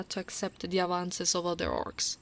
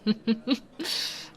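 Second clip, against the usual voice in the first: short rising giggle; light, amused laugh; teasing chuckle; 3 semitones higher than usual. Acted. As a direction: chuckle knowingly at their reaction. Teasing the human who remembers her.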